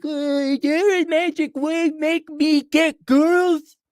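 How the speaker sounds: high, slowed mocking voice of a lazy client; mock clueless client. Mocking a lazy client, high and drawn out. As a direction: sneer it out as a dim freeloader.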